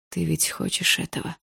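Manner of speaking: soft, insinuating question; hushed, crafty seduction; sly. A narrator's reading. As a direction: tempt her quietly. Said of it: "спросил он голосом, в котором слышалось коварство".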